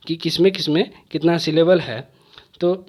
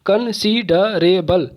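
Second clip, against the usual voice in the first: Considerable slowly split into five separate beats; syllable by syllable; much slower than usual. Shown as a demonstration. Teacher showing students five syllables, considerable broken up slowly. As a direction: break considerable apart, a pause after each syllable. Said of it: "तोड़ तोड़ के आवाज़".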